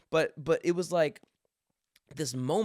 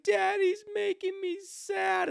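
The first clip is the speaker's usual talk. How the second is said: put-on very high, slow whimpering child; sad little daughter; slower than usual. Dad quoting his daughter's complaint, in a very high slow child voice. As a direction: whimper it like a hurt toddler. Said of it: "and she's like".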